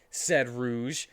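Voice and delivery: usual speaking voice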